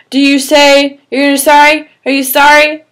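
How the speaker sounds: demand. Acted. Cameron demands apology.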